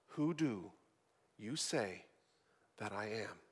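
preacher closing on Jesus' question, low and hushed. Acted. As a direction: breathe the question out softly. Question repeated in a hush.

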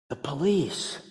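put-on very soft, small child's voice; little boy. A four-year-old answers the pastor, very soft.

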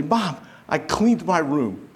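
lightly voiced child, drawn out; proud child. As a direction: announce it like an eager little boy. Retelling proud child reporting to mom.

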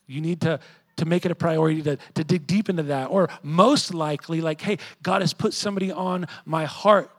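loud, driving sermon voice; preaching at full force. Acted. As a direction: preach it hard to the congregation.

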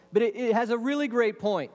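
ordinary delivery

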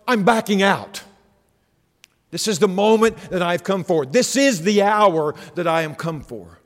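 fervent pulpit climax, loud and high; preaching at full force. Acted. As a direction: preach it out loud to the back row.

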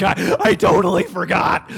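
loud, stammering panic; panicked shout. Retold or imitated. A kid confessing he forgot Mother's Day, shouting. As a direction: blurt it out in alarm. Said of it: "a kid came in earlier and said".

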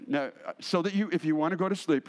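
ordinary delivery

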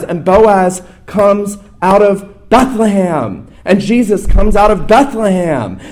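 high, loud, ringing sermon climb; impassioned pulpit crescendo; full-force preaching. Acted. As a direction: preach it out to the rafters.

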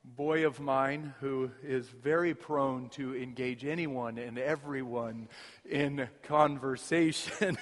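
ordinary delivery

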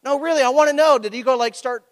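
high, insistent asking; eager questioner. Retold or imitated. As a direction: lean in, pressing for the answer. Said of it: "they look at me and they went".

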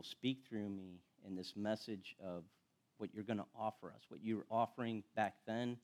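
hushed opening prayer. Acted. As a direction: pray quietly and humbly before the sermon. Opening prayer before the message.

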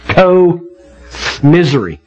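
slow, drawn-out co-misery; split into co-misery. Shown as a demonstration. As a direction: stretch "Co-misery" into its two parts, co and misery. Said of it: "What does that word sound like?".

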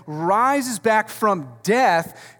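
drawn out slowly and loud, each word weighed; slow emphatic declaration. Real, not acted. Preacher to congregation, slow emphatic climax. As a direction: lean hard on every word.

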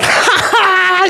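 high, drawn-out mocking "ha, ha, ha"; loud, slow mock laugh; imitated laughing. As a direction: imitate a kid laughing at you. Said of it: "you know, she's laughing".

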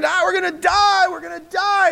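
high, loud panicked shout; terrified disciples yelling in the storm; panicked screaming. Retold or imitated. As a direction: scream it like the boat is sinking. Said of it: "freaking out and screaming".

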